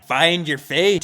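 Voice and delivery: Scottish accent. Shown as a demonstration. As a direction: put on a punchy Scottish accent. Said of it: "Scottish accent".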